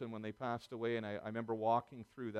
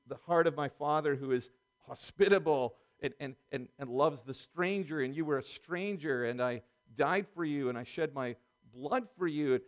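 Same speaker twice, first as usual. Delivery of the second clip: rising, louder preaching build; impassioned preaching; 4 semitones higher, 5 dB louder than usual. Acted. Pastor preaching God's welcome with mounting fervour.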